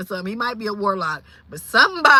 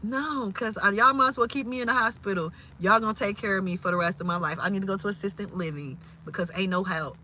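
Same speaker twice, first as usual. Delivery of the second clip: ordinary talk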